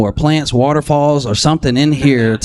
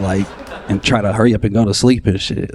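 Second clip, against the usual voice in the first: usual speaking voice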